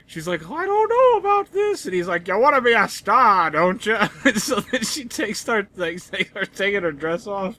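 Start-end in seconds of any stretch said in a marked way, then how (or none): 0.5-1.8 very high squeaky cartoon falsetto; badly faked cartoon mouse voice; Minnie Mouse. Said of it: "it's Minnie Mouse". 2.3-4.0 louder, pushy showbiz boss voice; mock persuasive mogul; Walt Disney. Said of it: "in front of Walt Disney".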